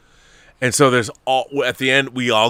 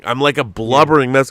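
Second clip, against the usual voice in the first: ordinary talk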